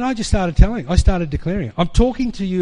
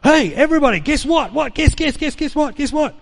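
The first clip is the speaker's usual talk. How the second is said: high, bursting, repeated "guess"; excited; 11 semitones higher than usual. Retold or imitated. Preacher calling twenty students to attention, thrilled and high. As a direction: bubble over, can't hold the news in. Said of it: "I got so excited".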